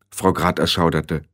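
usual speaking voice

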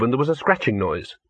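usual speaking voice

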